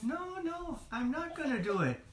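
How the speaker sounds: high, fast, protesting creature voice; high whiny Gollum denial; pleading Gollum. Acted. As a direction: beg to be believed, hurriedly. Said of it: "Gollum said".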